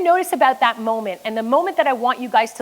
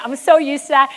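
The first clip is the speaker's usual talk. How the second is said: ordinary talk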